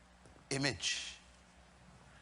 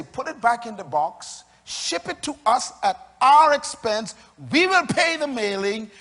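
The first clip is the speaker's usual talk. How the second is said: loud preaching; 5 semitones higher, 9 dB louder than usual. Acted. Preacher voicing company promise.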